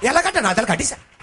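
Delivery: normal talking voice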